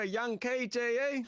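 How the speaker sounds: big excited intro call; hyped announcer intro. Acted.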